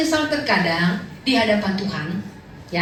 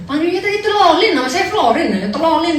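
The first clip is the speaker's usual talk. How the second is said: higher and louder, laughing; amused retort; 5 semitones higher, 4 dB louder than usual. Retold or imitated. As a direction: explain the name through laughter. Retold reply to the boss. (menjelaskan nama sambil tertawa, nada tinggi)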